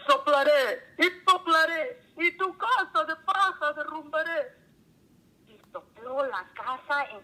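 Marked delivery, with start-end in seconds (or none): 0.1-4.6 fierce wolf. A narrator's reading. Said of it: "El lobo feroz contestó".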